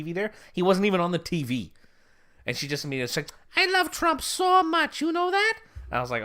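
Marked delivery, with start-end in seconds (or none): 3.6-5.5 much higher, slow, gushing mock Eastern European voice; Eastern European accent. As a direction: swoon over Trump in a put-on Eastern European accent. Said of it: "Eastern European accent".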